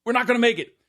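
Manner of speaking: high urgent call to drop it; a dad barking a sudden order, high; dad's call. Retold or imitated. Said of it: "My dad goes".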